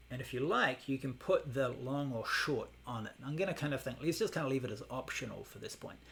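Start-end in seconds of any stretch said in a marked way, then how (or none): none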